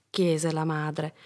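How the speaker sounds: plain reading voice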